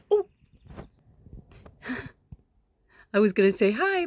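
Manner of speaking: normal talking voice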